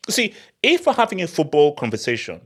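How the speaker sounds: usual speaking voice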